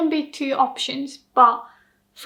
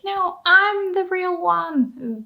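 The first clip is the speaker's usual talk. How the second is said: slow, higher, melodramatic no, I'm the real one; dramatic clone protest; 6 semitones higher, slower than usual. Acted. A clone in a TV show insists it is the real one, hammed-up. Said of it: "they would be seen like".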